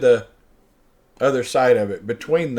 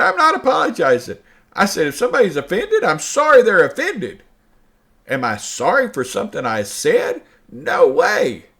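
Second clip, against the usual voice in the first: getting angry; 6 semitones higher, 4 dB louder than usual. Retold or imitated. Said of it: "now I'm getting angry".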